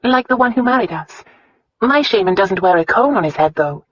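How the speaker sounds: Christina's voice. Acted. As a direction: lift the pitch into the heroine's voice. Voices the story's heroine.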